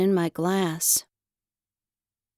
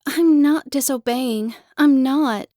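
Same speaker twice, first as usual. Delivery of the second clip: louder, higher desperate protest; insistent protest; 4 semitones higher, 6 dB louder than usual. Acted. Child insists to the teacher she isn't disobeying, loud. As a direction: push back hard, almost shouting.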